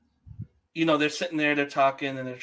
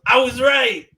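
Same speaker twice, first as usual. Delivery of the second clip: triumphant; 8 semitones higher, 9 dB louder than usual. Retold or imitated. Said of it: "And I was just like".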